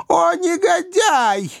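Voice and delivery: put-on very high, shrill-pitched old lady cry; shrieking old governess. A narrator's reading. Ugliest old governess shrieks at Pickwick, scandalised and high. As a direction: squeal it like an outraged old governess. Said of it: "воскликнула самая старая и безобразная".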